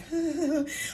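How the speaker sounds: acted shudder of holding it in; shaking shudder. Shown as a demonstration. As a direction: imitate a nervous shivery breath. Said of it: "like, I'm shaking".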